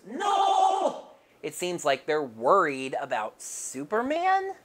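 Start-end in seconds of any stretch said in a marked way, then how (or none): 0.0-1.1 high squeaky robot scream; Alpha's scream. Acted. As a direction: shriek it way up high. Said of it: "Alpha screams".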